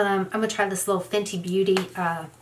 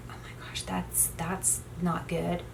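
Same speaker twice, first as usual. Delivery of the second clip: frightened whisper; 9 dB quieter than usual. Retold or imitated. Sudden eerie silence outside, she whispers in fear. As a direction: barely breathe it, afraid to be heard.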